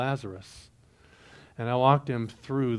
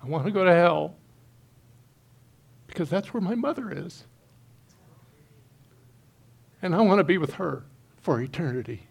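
real emotion lifting the voice higher; misty, halting and high-pitched; choked up; 5 semitones higher than usual. Real, not acted. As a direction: fight back tears, with long pauses between phrases. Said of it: "I just get a little misty".

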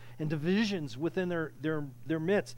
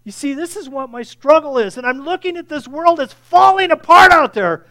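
much louder and higher, shouting the challenge; full-force preaching; 8 semitones higher, 18 dB louder than usual. Real, not acted. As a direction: thunder it from the pulpit. Preaching climax, self-rebuke.

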